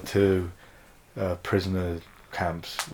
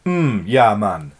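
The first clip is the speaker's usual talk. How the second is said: put-on lazy Jamaican accent, a bit louder; 4 dB louder than usual. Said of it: "Do a Jamaican one now".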